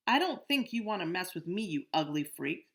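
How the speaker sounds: loud threatening jeer; a bully warning the boys off; menacing bully. Acted. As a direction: threaten him, spitting the insult.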